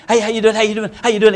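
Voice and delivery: a lightly voiced hyper kid, quick greetings; eager hyper greeting, lightly imitated; hyper Tom. Preacher imitating hyper Tom greeting everyone, mild hyper voice. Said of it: "He was just kind of a hyper".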